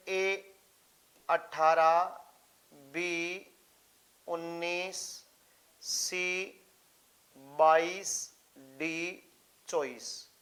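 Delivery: slow list with long gaps; slow dictation. Real, not acted. Teacher writing exam options for students, slow spaced-out dictation. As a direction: read out each option separately, slowly.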